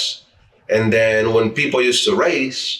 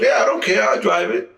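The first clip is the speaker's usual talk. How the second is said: mock careless owner; 9 semitones higher than usual. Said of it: "So he goes".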